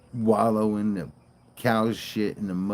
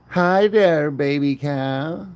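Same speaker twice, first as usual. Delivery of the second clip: much higher, louder, slow coo; 5 semitones higher, 10 dB louder, much slower than usual. Real, not acted. Greeting the calf.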